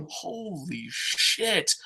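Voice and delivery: very slow, hushed disbelief; drawn-out exasperated curse, dropped in volume; stunned exasperation. Real, not acted. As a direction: drag it out softly in disbelief. Exasperated at commentary team.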